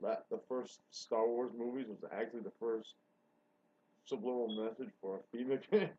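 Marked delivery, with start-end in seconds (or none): none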